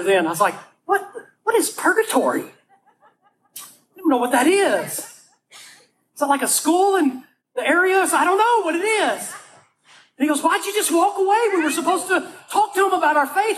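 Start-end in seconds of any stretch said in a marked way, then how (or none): 0.9-9.3 high, slow, laughing confusion; baffled. Retold or imitated. Baffled teen after hearing purgatory. 10.4-13.6 much higher, mock-exasperated call; a hint of an upset buddy; scolding friend. Friend scolds him for walking away.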